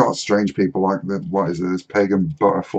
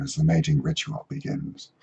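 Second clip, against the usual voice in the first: soft, low documentary narrator; hushed wildlife narration; David Attenborough; 5 semitones lower, 9 dB quieter than usual. Retold or imitated. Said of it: "I couldn't do his voice".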